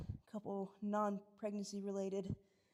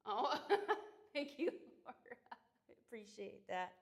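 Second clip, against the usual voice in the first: 1 laughing thanks; 3 semitones higher than usual. Real, not acted. Thanking viewer for compliment.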